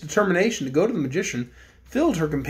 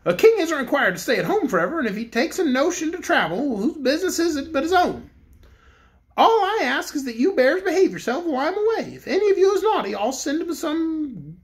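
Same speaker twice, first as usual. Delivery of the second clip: higher-pitched mock-stern king voice; a toy king giving a playful threat, pitched up; Bear King; 9 semitones higher than usual. A narrator's reading.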